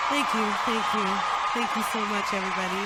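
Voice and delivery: loud, beaming thanks; excited thanks. Real, not acted. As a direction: thank the crowd warmly. Greeting the applause.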